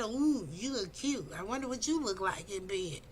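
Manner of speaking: lightly voiced creep sizing her up; mock sleazy come-on. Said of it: "I didn't come at you like that".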